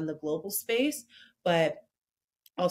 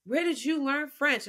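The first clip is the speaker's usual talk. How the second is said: imitating impressed strangers, pitched up; impressed surprise; 7 semitones higher than usual. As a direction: retell their pleasantly surprised question.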